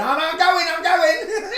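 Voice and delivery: mock-frightened squeak, very high, with a laugh; scared retreat. Mocking Dolores backing down, squeaky and very high. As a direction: play her fleeing in a panic, half-laughing. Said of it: "she went".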